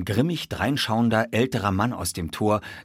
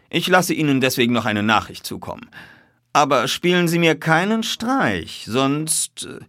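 higher, louder detective voice; warning detective; 8 semitones higher, 5 dB louder than usual. A narrator's reading. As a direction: give instructions, then leave a threat hanging.

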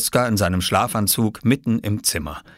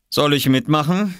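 higher-pitched, half-hearted parental warning; reluctant threat; 8 semitones higher, 3 dB louder than usual. Acted. Papa looking up from his paper, mildly reluctant. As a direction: offer it with little appetite for joining in. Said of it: "als hätte er keine große Lust dazu".

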